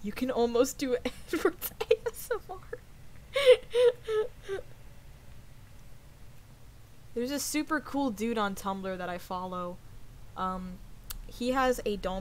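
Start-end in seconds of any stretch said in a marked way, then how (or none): 2.6-7.1 high, breathless laugh. Real, not acted. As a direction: laugh helplessly, pitched way up. Laughs at the idea of ASMR.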